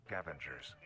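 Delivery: ordinary delivery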